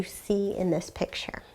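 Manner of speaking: hushed suspenseful question to the class; whisper. Real, not acted. Teacher builds suspense for the class.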